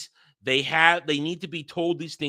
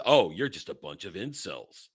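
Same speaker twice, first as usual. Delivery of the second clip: mocking guy; 8 semitones lower, 4 dB quieter than usual. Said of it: "guy says".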